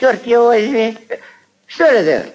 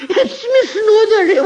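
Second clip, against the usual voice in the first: scornful laughter; 11 semitones higher, faster than usual. Acted.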